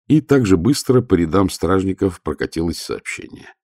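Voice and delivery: usual speaking voice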